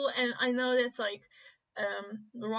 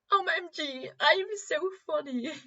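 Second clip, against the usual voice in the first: put-on childish voice; 7 semitones higher, 3 dB louder than usual. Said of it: "Just pretend to be, like, a child".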